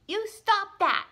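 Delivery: put-on high, loud, slow angry girl; high angry scolding; angrily. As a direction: scold them, furious little girl. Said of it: "she said angrily".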